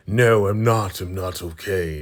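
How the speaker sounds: retold angry outburst, hit the first denial hard; upset, furious. Upset man answers a brother checking on him, mock-furious. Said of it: "He is upset, furious".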